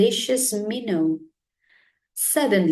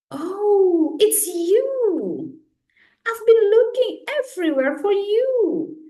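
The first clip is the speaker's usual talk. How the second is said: high, quick, thrilled; bubbling over with relief; excited discovery; 12 semitones higher than usual. A narrator's reading. Two birds finally finding each other, high and excited.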